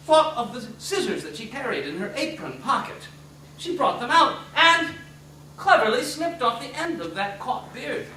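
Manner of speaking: ordinary delivery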